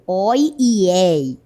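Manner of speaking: "oi e ei" very slow, open vowels sustained; open diphthongs. Shown as a demonstration. Teacher pointing out the diphthong, long open vowels. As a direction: draw out "oi", then "ei", both open. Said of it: "esse ditongo aberto".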